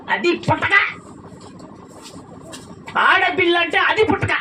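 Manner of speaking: usual speaking voice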